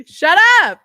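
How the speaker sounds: very high, loud, playful protest; laughing shriek. Real, not acted. Co-host embarrassed after singing, playful shriek. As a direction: squeal it out in mock outrage.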